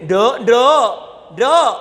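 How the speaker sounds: loud, high call of "Nduk". Acted. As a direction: call the girl sharply to get her attention. Calling the girl to attention.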